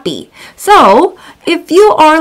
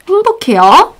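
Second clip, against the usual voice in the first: happy demo; 7 semitones higher, much faster than usual. Shown as a demonstration. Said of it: "are you 행복해요?".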